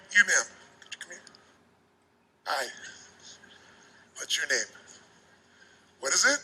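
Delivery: put-on a frightened child greeting an audience member, barely audible; scared little kid. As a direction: shrink away like a frightened child meeting a stranger. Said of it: "as if you were deathly afraid they were gonna reject you".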